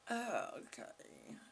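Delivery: soft whispered okay; whispered low okay. Real, not acted. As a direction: breathe it out quietly. After a sigh.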